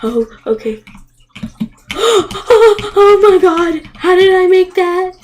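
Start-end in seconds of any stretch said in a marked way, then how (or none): 1.9-5.1 higher, louder gasp of disbelief; amazed. Real, not acted. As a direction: sound stunned that it worked. Surprised at own lucky jump.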